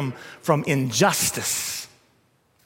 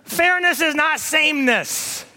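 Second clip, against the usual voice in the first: much higher, pressing; emphatic correction; 11 semitones higher than usual. Acted. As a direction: correct it firmly.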